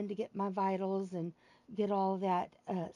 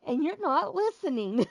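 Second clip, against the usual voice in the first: teasing comeback; 5 semitones higher, 7 dB louder than usual. Retold or imitated. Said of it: "or I, I will sell, tell them".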